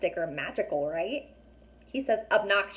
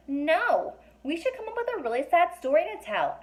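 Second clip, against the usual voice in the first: put-on girl voice; 8 semitones higher than usual. A narrator's reading. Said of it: "Alex said".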